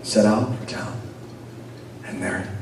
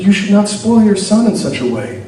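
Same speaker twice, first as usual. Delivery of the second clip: higher, louder scolding; indignant older woman; 9 semitones higher, 7 dB louder than usual. Acted. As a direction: lecture him sharply.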